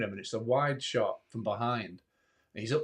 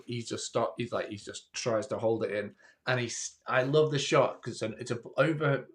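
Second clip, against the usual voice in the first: usual speaking voice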